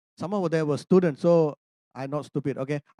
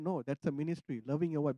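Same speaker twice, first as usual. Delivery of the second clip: quiet firm God; 3 semitones lower, 9 dB quieter than usual. Retold or imitated. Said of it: "I said".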